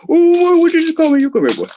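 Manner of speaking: put-on very high, loud outraged old man; shrieking furious grandpa; angry old man. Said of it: "my old man voice".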